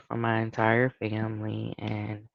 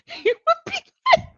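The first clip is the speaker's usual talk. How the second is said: high, giggling through the words; can barely get it out for laughing; real laughter breaking through the story; 5 dB louder, faster than usual. Real, not acted. Laughing over the memory.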